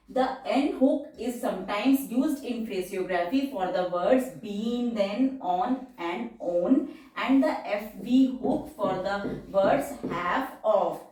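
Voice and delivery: slow, deliberate dictation of the rule; reading the rule slowly. Shown as a demonstration. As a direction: read it out for students to copy. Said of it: "reads rule slowly in English".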